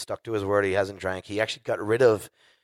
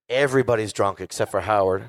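ordinary talk, nothing marked

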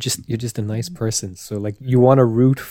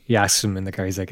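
usual speaking voice

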